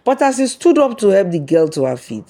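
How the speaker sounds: plain reading voice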